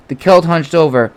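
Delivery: ordinary delivery, nothing marked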